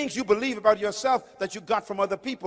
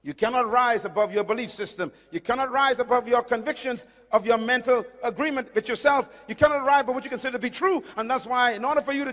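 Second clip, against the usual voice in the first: rising, louder preaching cadence; preaching build; 5 semitones higher, 5 dB louder, faster than usual. Acted. Preacher urging the congregation, building in force. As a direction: press each line harder.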